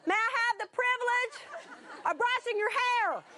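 high, slow projected request; hammed-up, drawn-out calling; speaking up. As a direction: call it out so the whole room hears. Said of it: "you're gonna have to speak up".

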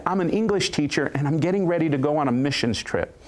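ordinary delivery

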